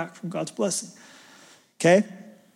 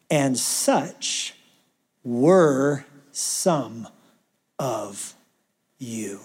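word by word, sinking low and soft; spaced out with long pauses, fading to hush; slow, weighty quote; 6 semitones lower, 3 dB quieter, much slower than usual. Acted. Paul reminding the Corinthians who they were, slow and grave.

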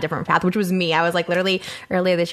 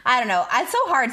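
ordinary talk, nothing marked

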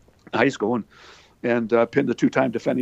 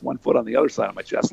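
ordinary talk

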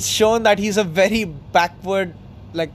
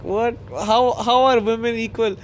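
higher-pitched imitation of disbelief; imitating a baffled man, pitched up; mock surprise; 5 semitones higher than usual. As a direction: lightly act out being taken aback, voice lifted.

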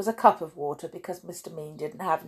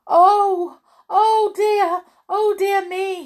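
high, loud storybook wail; 10 dB louder, slower than usual. A narrator's reading. Mister Mean seeing his money become potatoes, wailing. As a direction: wail it out, pitched way up. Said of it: "he wailed".

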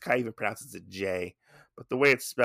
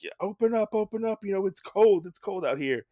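pleading high voice; 10 semitones higher, faster than usual. Retold or imitated. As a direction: pitch up and plead at the door. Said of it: "He's like".

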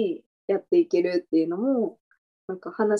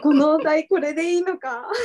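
laughing; 6 semitones higher than usual. Real, not acted. Laughing at own podcast topic.

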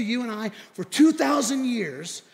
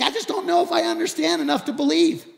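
high, louder plea of confusion; retold protest; 10 semitones higher, 6 dB louder than usual. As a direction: protest that you just can't get it.

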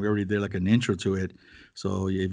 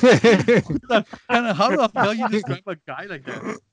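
mock indignant; 10 semitones higher, 6 dB louder, slower than usual. Mock outraged question.

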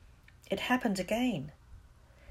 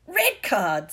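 loud, indignant exclamation; angry football fan's protest; furious; 3 semitones higher, 8 dB louder than usual. A narrator's reading. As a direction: snap it out, insisting. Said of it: "said Dean furiously".

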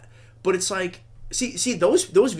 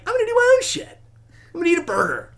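much higher, louder retort; high-voiced acted comeback; mock defiance; 13 semitones higher, 6 dB louder, faster than usual. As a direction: pitch it way up, insisting loudly. Acting out defiant reply to vegan.

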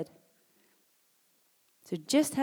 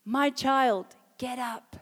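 raised, commanding call, strongest at the start; loud, higher quoted command; 4 semitones higher, 5 dB louder, slower than usual. A narrator's reading. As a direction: call it out firmly, a little higher. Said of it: "said in a loud voice".

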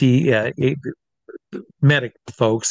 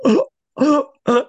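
high choking gasps; acted short, strained gasps; imitated gasping; 13 semitones higher, 4 dB louder than usual. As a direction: mimic a man struggling for air. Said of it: "this guy's going".

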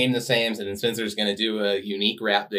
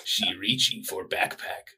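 slow, simple brute talk; lightly voiced caveman; 2 semitones lower, 7 dB quieter, slower than usual. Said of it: "keep this up 'cause it's funny".

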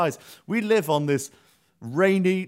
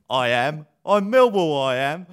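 mock fan's yell, loud and pitched up; retold battle cry; 2 semitones higher, 7 dB louder than usual. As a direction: shout it like a football terrace boast. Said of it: "shouting his own battle cry back at them".

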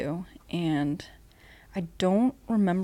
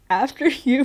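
nervous laugh; 7 semitones higher, 6 dB louder than usual. Retold or imitated. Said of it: "And I was like".

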